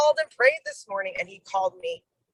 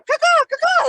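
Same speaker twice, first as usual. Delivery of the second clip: high mock crow cawing, a bit louder; crow caw imitation; 14 semitones higher, 6 dB louder than usual. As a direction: squawk it out like a nagging crow. Mimicking constant crow-like nagging.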